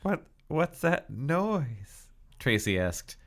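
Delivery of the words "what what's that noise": very slow, higher-pitched question; puzzled, drawn-out question. A narrator's reading. Tracy asks Dina about the crackling, slow and puzzled. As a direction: stretch each word out, voice lifted. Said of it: "could hear only a loud crackling sound".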